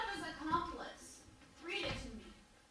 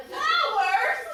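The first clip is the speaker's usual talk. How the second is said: loud, high startled question; much louder, pitched up in shock; incredulous outburst; 5 semitones higher, 12 dB louder than usual. Acted. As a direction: blurt it out in disbelief. Startled reaction to flowers on graves.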